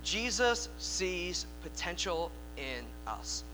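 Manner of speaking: each word drawn out, x0.5 slow; slow preacher's emphasis; slow emphatic repeat. Real, not acted. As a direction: let it sink in, very slowly. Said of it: "repeated line".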